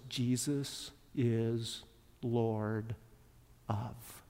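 low, soft, word by word; hushed, drawn-out phrase with gaps; slow dictation. Real, not acted. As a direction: dictate slowly so people can write it down. Dictating words to write down.

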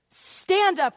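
high, loud command; yelled order. Acted. Teacher demanding Ryan stand up, yelling. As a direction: order them up sharply from the seat.

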